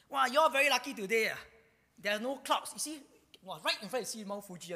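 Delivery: wowed and loud, acting out a guide; excited tour guide. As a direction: exclaim it like a thrilled guide. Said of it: "the tour guide said".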